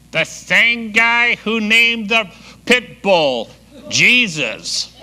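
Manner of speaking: high, slow parrot voice breaking into a laugh; parrot punchline. Acted. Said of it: "The parrot goes".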